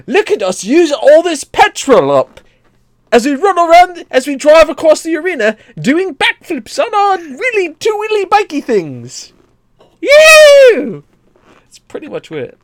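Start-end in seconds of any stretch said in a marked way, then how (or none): 0.1-11.0 high, loud hyped promo voice; over-the-top European ad read; European announcer. Pretend. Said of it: "but European audience".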